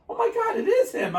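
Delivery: high shocked gasp of recognition; surprised. Retold or imitated. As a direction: squeak it in sudden realization. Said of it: "she goes".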